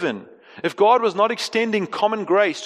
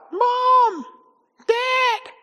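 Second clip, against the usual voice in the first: put-on high calling child's cry; high-pitched call for mom and dad. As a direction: call out like a scared kid at night.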